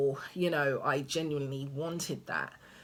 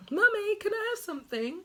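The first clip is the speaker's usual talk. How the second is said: put-on high, fast whiny child voice, far higher than her own; imitating her son; faster than usual. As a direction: mimic a small boy nagging mum for a snack. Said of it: "That's all I hear all day".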